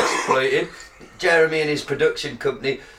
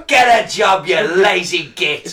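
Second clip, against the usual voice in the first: mock berating shout; 5 semitones higher, 6 dB louder, slower than usual.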